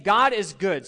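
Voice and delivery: loud, high "God is good"; declaring God is good. Shown as a demonstration. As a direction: proclaim it to the congregation. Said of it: "So say that".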